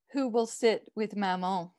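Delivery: plain reading voice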